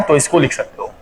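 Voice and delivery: normal talking voice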